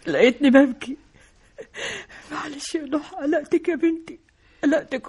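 tearful, breaking voice, going soft; mother apologising through tears; crying. Acted. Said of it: "بتعيطي ليه؟". (بتبكي بصوت مكسور)

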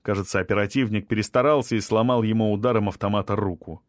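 plain reading voice

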